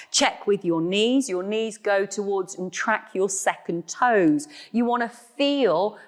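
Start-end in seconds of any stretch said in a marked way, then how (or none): none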